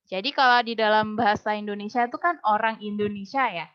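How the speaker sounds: ordinary talk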